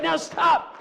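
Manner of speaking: loud, firm stop; preacher calling the crowd to stop; commanding shout. Real, not acted. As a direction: halt the room with a shout.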